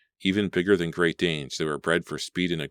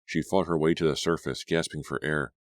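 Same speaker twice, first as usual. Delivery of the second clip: plain reading voice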